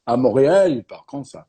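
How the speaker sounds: demonstrating Québécois French; French with a put-on Québécois twang; Montreal French accent. Shown as a demonstration. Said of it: "I'm trying to go to Montreal".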